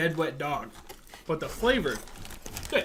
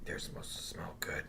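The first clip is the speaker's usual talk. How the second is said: whisper; 16 dB quieter than usual. Real, not acted. Whispered aside.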